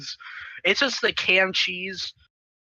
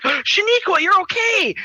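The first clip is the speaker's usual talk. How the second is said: high-pitched happy exclamation; mock relief; 10 semitones higher, slower than usual. Friends spotting Shaniqua alive, high relieved cry. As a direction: pitch it way up in pretend delight. Joyful reunion with lost friend.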